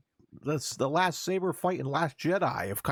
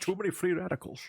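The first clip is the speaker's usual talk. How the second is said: put-on high, quick Connery accent; Sean Connery; 7 semitones higher, faster than usual. Co-host echoes the bit, mock Connery accent. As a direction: echo it in a Scottish Connery impression. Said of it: "you're very good at the Connery".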